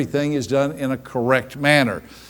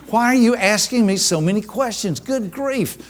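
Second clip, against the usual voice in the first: pitched-up, fed-up complaint ending much higher; exasperated; 7 semitones higher than usual. Retold or imitated. Speaker acting out her annoyance at a questioner, exasperated. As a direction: throw up your hands at all the questions, rising to a squeak. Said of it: "From my perspective".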